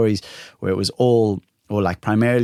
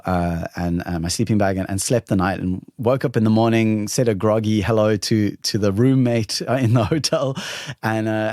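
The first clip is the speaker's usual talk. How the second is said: ordinary delivery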